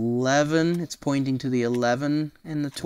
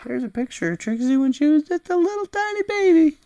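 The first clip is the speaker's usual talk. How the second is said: cooing; 4 dB louder, faster than usual. Real, not acted. Reader cooing at Trixie's baby picture, high and fast.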